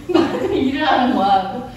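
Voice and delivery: ordinary delivery, nothing marked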